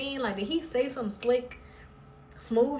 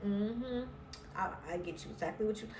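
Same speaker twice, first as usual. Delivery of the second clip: mock smooth murmur; 8 dB quieter than usual.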